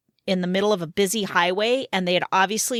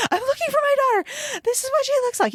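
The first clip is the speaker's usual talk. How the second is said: much higher, rushed, panicked pleading; frantic mother; faster than usual. Retold or imitated. Retelling how she begged the storage clerk for her lost daughter, frantic. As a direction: blurt it out as a desperate parent. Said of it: "going crazy".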